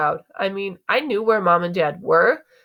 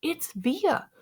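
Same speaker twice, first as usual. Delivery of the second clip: soft, higher-pitched hushed voice; whispered; 9 semitones higher, 6 dB quieter than usual. A narrator's reading. Mom to Dad at the play, whispered. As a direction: hush it, as if to him alone. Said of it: "Mom whispered to him".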